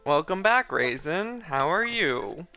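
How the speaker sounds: ordinary delivery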